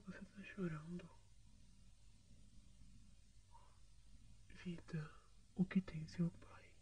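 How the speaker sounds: soft, anxious whispering; worried whisper. Acted. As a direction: hush and comfort her. Comforting crying partner in whispers. (sussurro preocupado e carinhoso)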